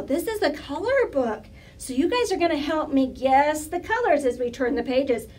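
plain reading voice

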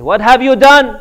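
loud, high accusing shout; angry confrontation. Retold or imitated. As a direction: shout at him for what he's done. Family confronts husband over divorce text.

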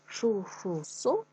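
each syllable Шу-шу-су stretched out slowly and apart; Шу-шу-су spoken slowly, syllable by syllable, for children to repeat; slow syllable drill. Shown as a demonstration. Teacher drills kids on a tongue twister, slow syllables. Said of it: "я шкарпеточки сушу".

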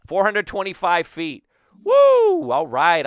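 loud, high announcement into a whoop; delighted cheer. Real, not acted. Player reading his homer's distance, delighted and loud. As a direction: proclaim it loudly, then let out a woo. Finds the home run distance.

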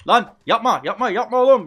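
high, loud panicked yell of stop; alarmed shout. Real, not acted. Gamer yelling at a friend hitting him by mistake, loud alarmed shout. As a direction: shout at a teammate to stop right now. Teammate hitting wrong target.

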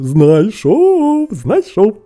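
high, loud cry of discovery; excited shout. A narrator's reading. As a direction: call out your lucky find. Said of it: "і вигукнув".

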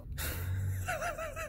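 a sudden high-pitched laugh; high giggle. Real, not acted. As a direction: burst into a squeaky laugh at yourself. Laughing at his own outburst.

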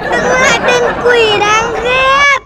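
high, slow call; calling after. Acted. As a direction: shout after the fleeing demon. Hero yells after fleeing demon.

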